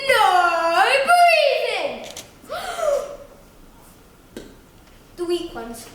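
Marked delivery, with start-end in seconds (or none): 0.1-2.1 long drawn-out booming shout; strict teacher. Acted. Strict teacher to her class, loud drawn-out command. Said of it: "once more from the beginning". 2.1-5.1 huge sudden gasp of held breath; exaggerated gasp. Pupil forbidden to breathe, exaggerated gasp.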